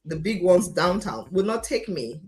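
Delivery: normal talking voice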